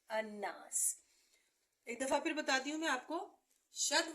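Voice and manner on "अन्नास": अन्नास with held nn, falling; doubled noon. Shown as a demonstration. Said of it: "अन्ना सीन साकिन".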